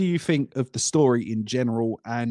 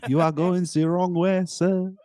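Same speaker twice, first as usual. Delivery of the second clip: higher mock French GPS voice; French-accented satnav; 4 semitones higher than usual. Satnav sending the driver back, in a fake French accent. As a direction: give directions in a hammy French accent. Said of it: "that was the, my best accent".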